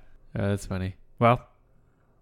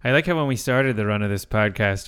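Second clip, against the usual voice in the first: ordinary talk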